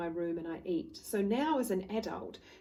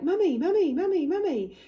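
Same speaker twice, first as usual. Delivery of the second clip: put-on high, loud child calling for mum; whiny child; 10 semitones higher, 9 dB louder than usual. Small child pestering mother for attention, high loud whine. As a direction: tug at her sleeve, voice high and insistent. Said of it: "They're like children. They're like".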